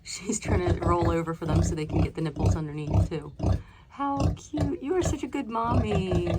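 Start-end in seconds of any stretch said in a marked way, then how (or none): 3.9-6.3 tender praise. Real, not acted. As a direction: praise her like a pet. Praising the sow.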